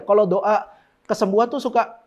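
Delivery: ordinary talk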